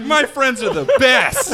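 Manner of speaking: loud, slow and laughing; laughing delight. Retold or imitated. Groom cheering the raid he thinks is fake, laughing loudly. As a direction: gush with giddy joy.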